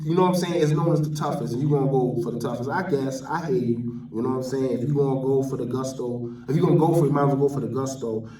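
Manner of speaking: ordinary talk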